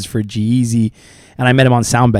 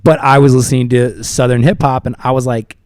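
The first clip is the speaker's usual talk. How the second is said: usual speaking voice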